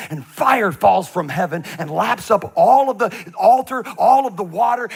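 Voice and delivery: excited build. Real, not acted. Preacher building to the fire from heaven, loud and slow. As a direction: swell the voice as fire falls.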